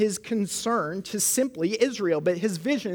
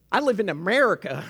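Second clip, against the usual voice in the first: mock Southern accent, a bit louder; attempted Southern accent; 2 semitones higher, 5 dB louder than usual. Preacher mimicking someone who dismisses the world, joking Southern accent. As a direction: put on a hammed-up Southern American accent. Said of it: "my attempt at a Southern accent".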